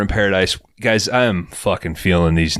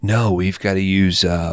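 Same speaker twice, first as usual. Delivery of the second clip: ordinary delivery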